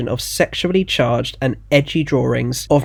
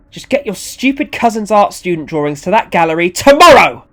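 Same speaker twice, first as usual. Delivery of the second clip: loud, raised-pitch demand, hardest on the last word; angry order; 7 semitones higher, 9 dB louder than usual. Acted. Organiser ending the call on Ronnie, angry. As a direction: give the order angrily, building to a shout.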